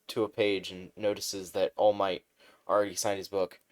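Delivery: ordinary delivery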